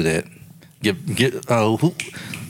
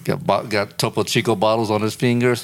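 ordinary talk

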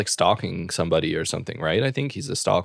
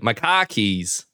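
slow r-less Boston "car keys"; Boston accent; 2 semitones higher, much slower than usual. Shown as a demonstration. Host gives practice phrase in Boston accent. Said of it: "Or, like".

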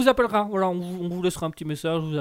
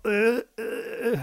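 high nasal-free silly uncle voice, much higher; Tonton Jackie; 6 semitones higher, much slower than usual. Acted. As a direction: play the corny uncle, pitched up. Tonton Jackie's put-on laugh voice. (oncle ringard, voix aiguë)

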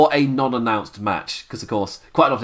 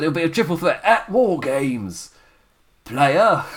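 lightly mimicked announcement, pitched up; a hint of a showy proclamation, higher than usual; Regal; 4 semitones higher, slower than usual. Host retelling Regal's triple threat announcement, lightly imitated proclamation. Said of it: "he just, uh, said".

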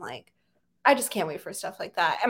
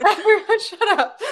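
high, loud, laughing command; 11 semitones higher, 6 dB louder than usual. Real, not acted. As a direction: tell everyone off while laughing. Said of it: "but really".

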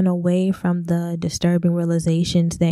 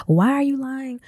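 put-on high, pleading cry of the inner child; inner voices yelling; 6 semitones higher than usual. Speaker's inner selves crying out to her, high and pleading. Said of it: "are all yelling".

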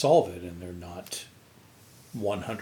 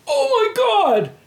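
a much higher, louder shriek of alarm; startled shriek; 10 dB louder than usual. Retold or imitated. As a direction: scream it like you just saw a mouse. Girlfriend spots a mouse.

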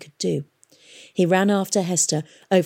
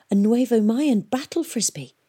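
higher-pitched, mild amazement; gasped; 5 semitones higher than usual. A narrator's reading. Boy recognises a rare museum weapon, gasping. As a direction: marvel at it with a touch of a gasp. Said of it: "gasped Tom".

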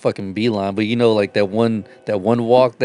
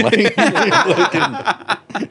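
laughing; 9 semitones higher, much slower than usual. Real, not acted.

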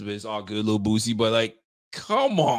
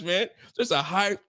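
usual speaking voice

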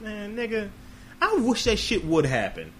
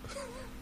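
high laugh; 17 dB quieter than usual. Real, not acted. Laughing before movie quote.

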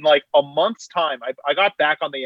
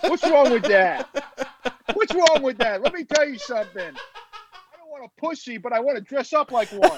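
mock character voice; 11 semitones higher than usual.

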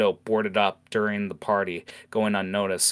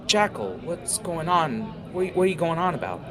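confused Heather; 9 semitones higher than usual. Acted.

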